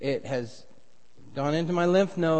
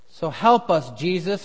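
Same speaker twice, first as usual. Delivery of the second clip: earnest pulpit prayer, swelling louder mid-way; 4 dB louder than usual. Acted. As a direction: pray it over the congregation. Pastor leads opening prayer.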